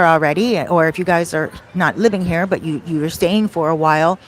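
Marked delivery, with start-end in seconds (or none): none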